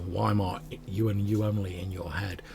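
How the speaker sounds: ordinary talk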